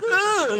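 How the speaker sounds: a sudden high shriek of disgust; disgusted squeal. Retold or imitated. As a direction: shriek at the roach. Squeals at huge roach.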